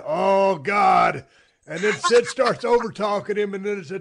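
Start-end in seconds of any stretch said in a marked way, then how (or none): 0.1-1.2 louder mock exclamation; imitating Sid. Host imitating Sid's on-air reaction, loud mock cry. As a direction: blurt it out like Sid. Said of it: "And then you can hear Sid".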